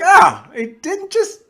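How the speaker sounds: high laughing squeal, slowed. Real, not acted. Fan retelling his reaction to the scene, laughing high.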